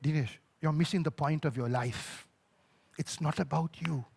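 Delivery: low, calm, quiet correction; soft-voiced fatherly reproof; gentle father. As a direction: tell him tenderly where he went wrong. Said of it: "it was a father saying".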